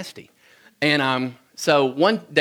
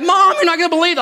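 much higher, louder excited yell; thrilled shout; 6 dB louder, faster than usual. Retold or imitated. Boy running to mom with his watermelon, thrilled high yell. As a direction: burst it out to mom in triumph.

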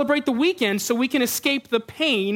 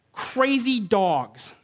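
normal talking voice

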